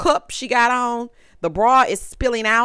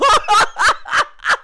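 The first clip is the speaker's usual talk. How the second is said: high, loud burst of laughter; cracking up at her own joke; 5 dB louder than usual. Real, not acted. Host laughing at her pun, loud laugh.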